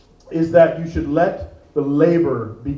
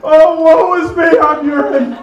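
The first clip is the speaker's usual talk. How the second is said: high, slow wailing woe; mock tragic lament; 12 semitones higher, 6 dB louder than usual. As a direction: ham up a tragic sigh as the urine. Comic self-pity as urine.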